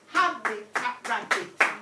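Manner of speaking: "rabitat" said loud and clear, r swapped in for h; rhyming demo. Shown as a demonstration. Teacher showing the class a made-up rhyme, loud and clear. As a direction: swap the h of "habitat" for r and announce it loudly. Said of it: "I've made a new word up".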